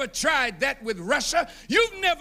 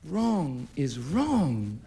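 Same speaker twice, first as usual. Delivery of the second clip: slow, low and deliberate; each word weighed, very slow and low; grave pronouncement; 10 semitones lower, 4 dB quieter, much slower than usual. Acted. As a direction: lay it down like a final verdict. Solemn moral verdict.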